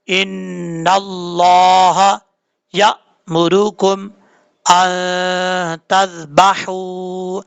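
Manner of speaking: very slow, measured recitation with long held vowels; practice Quran recitation. Shown as a demonstration. Teacher leading students through the line, slow Quran recitation. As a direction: draw out each word for learners to follow. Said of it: "practice के तौर पे पढ़ लेते हैं".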